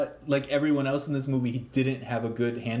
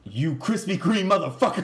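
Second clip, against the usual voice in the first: higher, mock-angry film quote; movie line; 4 semitones higher, 3 dB louder than usual. Cohost quoting the film's best line, mock outrage. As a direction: deliver the insult like a big movie line. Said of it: "What was the best line in this movie?".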